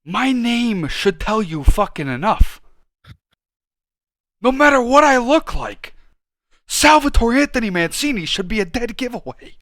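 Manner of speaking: hammering home that his name says it all; indignant rant. Real, not acted. To his co-host about people who can't tell he's Italian, exasperated loud rant.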